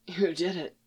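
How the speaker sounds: soft rasp, barely voiced. A narrator's reading. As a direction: rasp it out weakly. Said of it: "Jaskier rasped".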